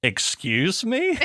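high, drawn-out shocked question; stretched-out, scandalised double take; incredulous. Retold or imitated. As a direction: rise sharply in taken-aback disbelief. Taken aback by misunderstood slang.

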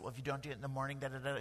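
hushed mock sermonette; mock legalistic voice. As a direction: mimic a nagging rule-keeper under your breath. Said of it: "I'm not gonna get all legalistic".

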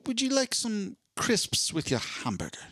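high-pitched mock British server; playful faux-British voice; British accent. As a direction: offer it in a put-on British accent. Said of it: "they call French fries over there".

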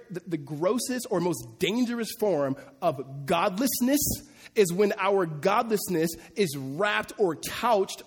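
ordinary talk

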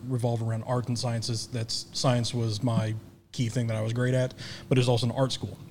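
normal talking voice

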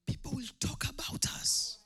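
a hushed, breathy whisper; whispered aside. Acted. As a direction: lean in and whisper it like a secret. Aside after quiet build.

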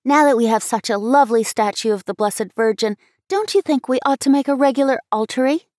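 put-on a child pleading with her mother; girl asking for an altar; eager little girl. A narrator's reading. Abby voiced by narrator.

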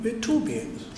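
ordinary talk, nothing marked

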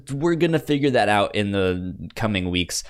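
normal talking voice

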